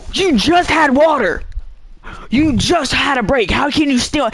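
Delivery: you just had a break, come on; exasperated scolding. Retold or imitated. Player scolds teammates who walk back after water, exasperated.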